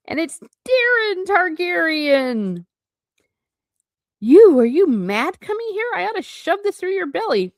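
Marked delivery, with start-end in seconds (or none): 4.2-7.5 angry outburst. Acted. Dunk confronts the prince.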